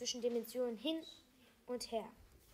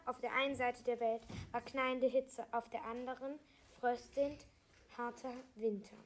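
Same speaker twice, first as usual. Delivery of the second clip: ordinary delivery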